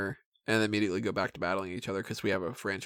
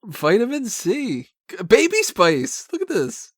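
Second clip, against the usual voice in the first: much higher, slow and amazed; excited; 13 semitones higher, 6 dB louder than usual. Real, not acted. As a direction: marvel at each name. Reading credits in disbelief.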